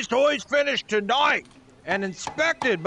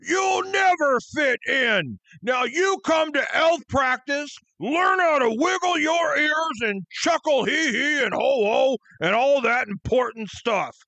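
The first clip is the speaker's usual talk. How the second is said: shouted, belittling orders; scornful boss; 9 semitones higher, slower than usual. Acted. Boss sending misfit elf to practice, scornful. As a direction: bellow the put-down and the orders.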